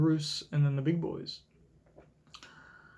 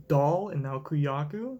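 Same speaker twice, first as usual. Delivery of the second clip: ordinary delivery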